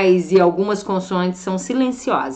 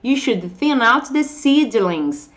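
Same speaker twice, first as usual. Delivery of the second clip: slow model sentence; 3 semitones higher, slower than usual. Shown as a demonstration. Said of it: "Vamos colocar dentro de uma frase".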